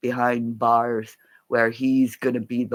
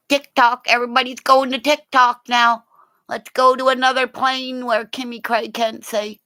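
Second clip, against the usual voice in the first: mocking rant; 11 semitones higher, 3 dB louder than usual. Real, not acted. Mocking rant about TikTok.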